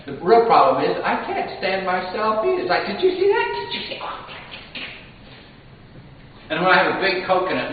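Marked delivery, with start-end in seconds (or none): 2.8-3.5 high, eager did you see that; bragging to the whole room; high gloating. Retold or imitated. Mimics his own gloating. 3.5-4.3 whispered gloat. Retold or imitated. Mimics his own gloating.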